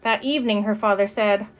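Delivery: ordinary delivery, nothing marked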